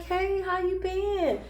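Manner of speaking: high, slow, sugary mock greeting of the witches; fake sweet greeting. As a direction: imitate a falsely friendly witch greeting them. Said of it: "They like".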